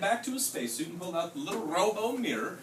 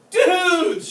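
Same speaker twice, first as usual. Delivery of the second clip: high, loud excited shout; 12 semitones higher, 12 dB louder than usual. A narrator's reading. Scipio shouting to his buddies, high loud yell. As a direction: yell it out to the dudes. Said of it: "shouted Scipio".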